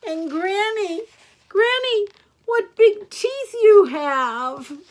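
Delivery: put-on loud, high little-girl alarm; mounting storybook fright, drawn out at the end; distressed. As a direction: cry it out slowly, aghast. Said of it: "was distressed".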